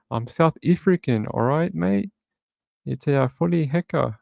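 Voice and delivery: put-on jokey amateur South African accent. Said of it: "I don't have a very good South African accent".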